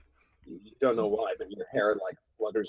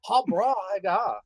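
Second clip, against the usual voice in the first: surfer dude; 8 semitones higher, 4 dB louder than usual. Pretend. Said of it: "surfer dude".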